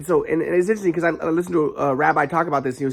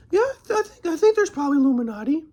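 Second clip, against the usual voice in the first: much higher put-on voice; imitating grandpa; 12 semitones higher than usual. Grandpa answering grandson about the Illuminati, high mock voice. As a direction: play him as a dopey old believer. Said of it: "my grandpa was like".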